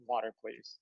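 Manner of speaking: low, soft, hungover request; acting out a subdued, feeble little plea. Hungover son to parents offering drinks, low and subdued.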